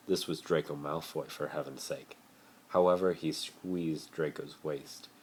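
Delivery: plain reading voice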